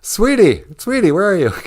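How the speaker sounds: ordinary delivery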